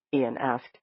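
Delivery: usual speaking voice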